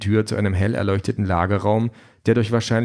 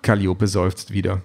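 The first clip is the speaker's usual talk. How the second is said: plain reading voice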